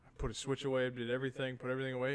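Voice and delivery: ordinary delivery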